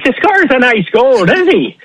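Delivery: lightly voiced Irish accent, pitched high; a hint of Irish brogue with a laugh; Irish lilt goal line. Shown as a demonstration. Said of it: "I wanna see you do the Irish accent".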